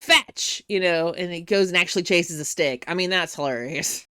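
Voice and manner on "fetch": high, loud playful call; called-out dog command. Retold or imitated. Vampire sending the dog after a stick, calling out. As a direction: throw the word out like a stick.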